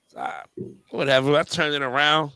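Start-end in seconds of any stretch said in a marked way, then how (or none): none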